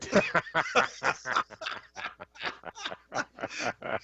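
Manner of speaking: breathless laughter, trailing on softly; long amused laugh. Real, not acted. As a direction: crack up for several seconds. Cracks up at viewer's comment.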